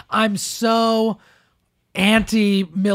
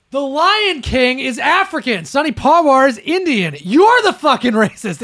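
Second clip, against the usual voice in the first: louder, rising indignation; angry rant; 5 semitones higher, 5 dB louder than usual. Real, not acted. Host ranting at the tweeter, angry raised voice. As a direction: lay into the tweeter.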